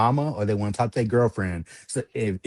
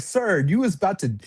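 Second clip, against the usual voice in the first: ordinary talk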